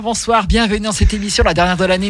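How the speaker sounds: high, bright on-air welcome; radio host welcome. Acted. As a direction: greet listeners like a DJ. Opening the radio show.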